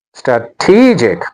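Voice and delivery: "strategic" slowed, with a long TEE; stress on second syllable. Shown as a demonstration. Teacher modelling the next word, "strategic" slowly stressed stra-TEE-jic. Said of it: "The stress is on".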